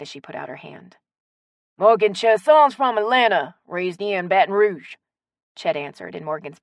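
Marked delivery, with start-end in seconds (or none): 1.8-5.0 loud, drawling local man; hearty raised voice, stretched vowels; friendly Southern shopkeeper. Acted. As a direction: introduce her proudly for everyone to hear.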